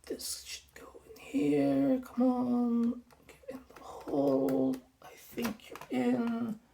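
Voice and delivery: concentrated whisper, coaxing the screw; threading a needle. Real, not acted. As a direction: whisper it while focusing hard. Fiddling a screw into hole.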